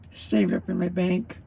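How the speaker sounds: ordinary talk, nothing marked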